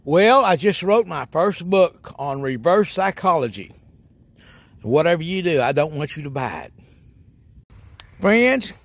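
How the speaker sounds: ordinary delivery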